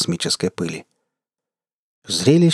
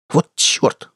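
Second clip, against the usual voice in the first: higher, exasperated curse; cursing; 5 semitones higher than usual. A narrator's reading. Gunner swears as pirates escape, cursing. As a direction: swear in frustration. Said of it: "выругалась Джаяна".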